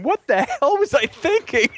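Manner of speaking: high, drawn-out self-reproach; mock incredulous. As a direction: wail it at your past self, half laughing. Mocks his past self in hindsight.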